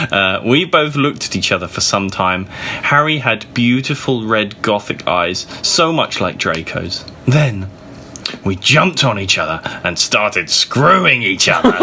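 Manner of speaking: plain reading voice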